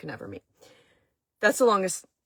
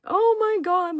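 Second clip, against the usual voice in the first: high, loud retold wail of dismay; raised, hammed-up distress; mock dismay; 13 semitones higher, 5 dB louder, slower than usual. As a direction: play up the panicked reaction. Retold reaction to bad news.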